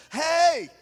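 ordinary talk

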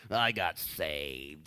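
hammed-up dreary voice; mock gloomy. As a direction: sound like you were baptized in pickle juice. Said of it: "baptized in pickle juice".

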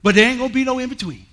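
loud, high, fast declaration; a touch of swagger to big dog; retold bold all-in call. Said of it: "I said".

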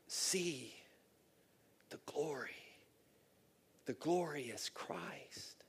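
soft, slow, fading into a whisper; awed hush. Real, not acted. Preacher telling church of seeing Christ, hushed. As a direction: breathe it out in awe.

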